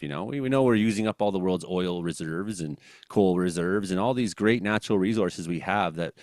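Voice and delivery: normal talking voice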